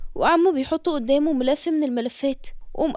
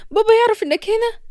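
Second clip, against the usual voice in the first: faster, pressing and higher; hurried alarmed repeat; urgent repeat; 7 semitones higher, 6 dB louder, faster than usual. Acted. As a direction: rush it out, panicking.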